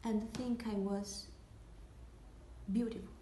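halting, with long dreamy pauses between phrases; hushed, lingering stage confession; wistful monologue. Acted. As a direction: drift through the daydream, letting it fade softly at the end.

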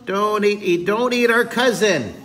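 high, loud slogan chanting; protesting fish. Pretend. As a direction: stretch it out like a fish on a picket line. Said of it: "they're carrying signs".

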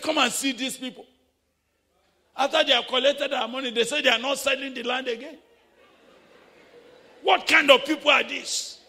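loud, high, fast angry rant; furious outburst. Retold or imitated. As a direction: vent outrage at the cheating sellers. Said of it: "Very furious".

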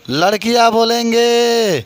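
"लड़कियां बोलेंगे" stretched out slowly, half speed; drawn-out prompt. Real, not acted. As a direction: call "लड़कियां बोलेंगे" out to the class, lengthening the words. Repeats call, prompting class to answer.

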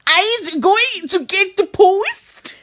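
slow, drawn-out mock German; bad German accent. Told to sound angry, slow mock German accent. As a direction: drag it out in fake German. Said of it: "that's meant to be German".

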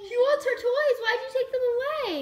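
high, laughing protest. Real, not acted. Mother laughs asking why the toys were taken, high laughing voice. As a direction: laugh through it, pitch up.

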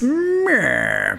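lightly voiced scheming villain; a hint of a sneering villain; mustache twirling villain. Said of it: "That's how he sounds".

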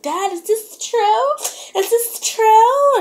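high, slow, wailed plea; hammed-up wail, stretching the last word; imitating upset daughter. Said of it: "she was like".

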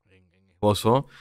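ordinary delivery

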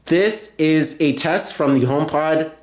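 slow, deliberate words for the HomePod to record; slow dictation. Real, not acted. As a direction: dictate it slowly and clearly to the speaker. Dictating intercom message to HomePod.

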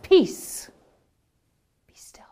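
Peace loud, pause, be still whispered; calm the storm with a word; peace, be still commanding then hushed. Shown as a demonstration. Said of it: "isn't afraid of silence".

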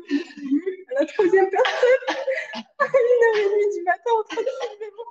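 high, crying with laughter; laughing to tears. Real, not acted. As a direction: gasp the words out through laughter.

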